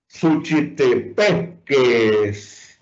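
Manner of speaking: Suchitepéquez slowly, syllable by syllable, hitting "pé"; stress on penultimate syllable. Shown as a demonstration. Teacher quizzing on the tonic syllable, Suchitepéquez stressed on "pé". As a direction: break up Suchitepéquez and land on "pé". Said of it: "¿dónde está la sílaba tónica?".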